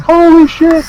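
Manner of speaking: high, loud mock astonishment; hammed-up starstruck exclamation; imitated amazement. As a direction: cry it out like a thrilled fan. Amazed fan, retold.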